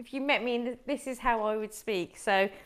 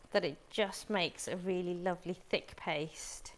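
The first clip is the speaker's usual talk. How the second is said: whispered aside; 3 semitones lower, 9 dB quieter than usual. Real, not acted. Murmured aside while cooking.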